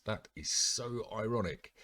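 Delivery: high, slow, loud mock-amazed "ironic"; ironic exclamation. Real, not acted. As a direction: relish the irony, stretching it out. Reader remarks on the irony.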